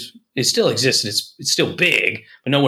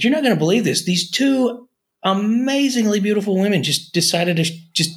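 excited neighbor; 6 semitones higher than usual. Retold or imitated. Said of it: "He's like".

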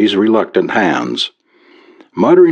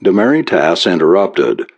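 ordinary delivery, nothing marked

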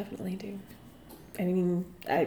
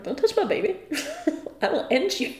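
laughing threat; 7 semitones higher than usual. Retold or imitated.

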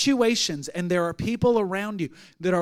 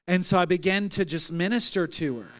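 ordinary talk

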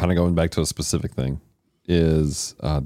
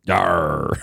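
gruff pirate yar. Pretend. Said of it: "why do you sound like a pirate".

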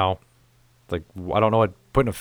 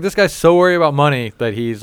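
indignant; 6 semitones higher, 7 dB louder than usual. Retold or imitated. Indignant about old dentist.

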